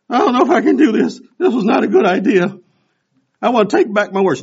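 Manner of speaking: high, loud, breathless gasping; huffing and puffing. Retold or imitated. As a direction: wheeze it out like you're dying on a walk. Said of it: "I'm huffing and puffing".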